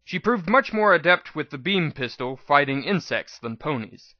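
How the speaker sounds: usual speaking voice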